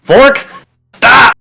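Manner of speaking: high, loud cry of stop; in-character shout. Acted. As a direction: shout a command at him. Player character shouting at Vork.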